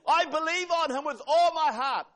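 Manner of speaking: loud high cry of faith; fervent declaration. Real, not acted. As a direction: proclaim it with all your heart. Congregation response, preacher declares faith.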